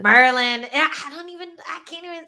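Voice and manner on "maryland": loud, high "Maryland" as "Murlin", Maryland accent. Shown as a demonstration. Answering what the Maryland accent is, Maryland accent. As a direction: punch out a two-syllable Maryland "Murlin". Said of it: "What is the Maryland accent?".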